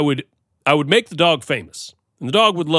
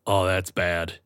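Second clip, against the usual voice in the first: ordinary talk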